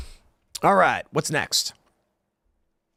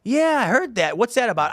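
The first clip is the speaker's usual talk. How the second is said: higher, eager poker-table gossip; a buddy lapping up a rumor, voice raised; gossiping guy; 7 semitones higher than usual. Retold or imitated. At the poker table, a guy answers his buddy, lightly voiced higher. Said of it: "some guy's gonna go".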